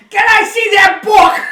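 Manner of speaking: high, blaring yelled question; loud comedian impression. Comedian in a library, yelling a request. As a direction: ask for it at top volume. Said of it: "You're trying to, like, be in a library".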